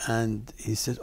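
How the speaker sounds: usual speaking voice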